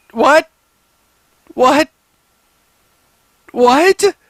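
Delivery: loud high baffled what. Real, not acted. Baffled repeated questions.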